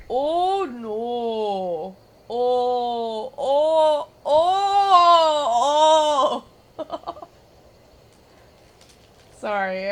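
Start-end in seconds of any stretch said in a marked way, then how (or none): 0.1-6.8 rising, louder, wailing Minnesota "Oh"s; mocking Minnesota oh. Mocking Jace's accent, building to a loud wailing "Oh". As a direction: ham up the Minnesota accent into a wail. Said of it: "I'm mocking him".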